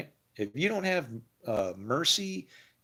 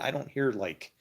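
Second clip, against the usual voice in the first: ordinary talk, nothing marked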